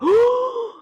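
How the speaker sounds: long, high theatrical gasp; mock shocked gasp. Dorian seeing the painting, mock shocked gasp. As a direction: gasp in exaggerated horror.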